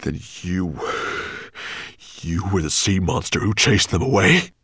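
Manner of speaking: loud, menacing dragon; growling accusation. A narrator's reading.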